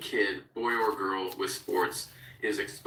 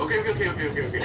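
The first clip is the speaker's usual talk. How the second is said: high, loud pleading okays tumbling out; rapid-fire mock tap-out, pitched way up; mock panicked surrender; 8 semitones higher, 5 dB louder, much faster than usual. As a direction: imitate a kid frantically giving in. Boys give in once grappled.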